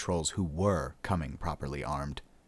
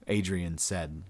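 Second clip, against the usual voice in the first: ordinary delivery, nothing marked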